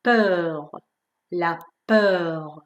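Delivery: Peur with a long rounded vowel between o and e; carefully modelled, lengthened peur; drawn-out French eu. Shown as a demonstration. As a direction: hold the eu in peur before the French r. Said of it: "یکم این دفعه کشیده تر از ژ".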